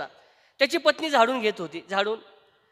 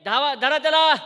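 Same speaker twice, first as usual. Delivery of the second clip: loud shout; 3 semitones higher, 5 dB louder than usual. Acted. Minister shouts to the guards to seize the man, loud. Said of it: "तो मोठ्याने ओरडला".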